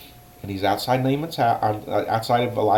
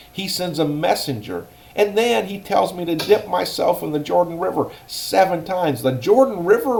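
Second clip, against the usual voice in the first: high, loud offended ranting; a proud soldier insulted and fuming; indignant rage; 8 semitones higher, 5 dB louder than usual. Acted. Naaman scorning the Jordan River, furiously indignant. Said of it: "He got angry".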